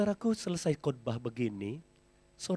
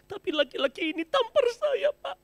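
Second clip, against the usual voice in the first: indignant wife; 5 dB louder than usual. Retold or imitated. Wife complains to pastor.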